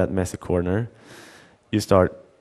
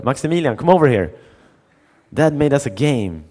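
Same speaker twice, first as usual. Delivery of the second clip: higher, louder call across the house; 7 semitones higher, 4 dB louder than usual. Retold or imitated. Older son calling his brother over, loud excited call.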